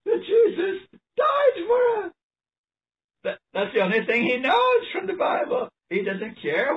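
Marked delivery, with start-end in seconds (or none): 0.1-2.1 high-pitched, drawn-out cry of conviction; emphatic exclamation. Real, not acted. Preacher answers his own question for the congregation, emphatic exclamation. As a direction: let it soar, slow and high.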